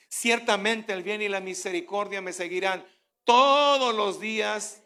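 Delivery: loud fervent recitation of the psalm; preaching declaration. Acted. Preacher shows the congregation how to declare the Word, booming. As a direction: proclaim every line with full force.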